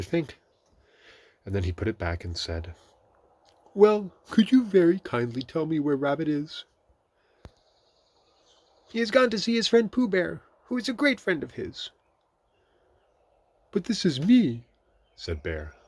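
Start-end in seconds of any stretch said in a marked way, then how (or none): 3.7-6.6 high, loud courteous question; polite Pooh. A narrator's reading. Pooh asking the voice where Rabbit is, polite. 8.9-11.9 very high put-on voice; disguised Rabbit. A narrator's reading. Rabbit fibbing to Pooh from his hole, disguised. 13.7-14.7 high, loud surprised bear. A narrator's reading. Pooh hearing that Rabbit went to see him, surprised. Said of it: "very much surprised".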